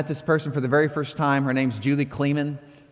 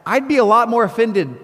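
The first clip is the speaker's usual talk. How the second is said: incredulous outburst; 7 semitones higher, 5 dB louder than usual. Retold or imitated. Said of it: "I read that and I think".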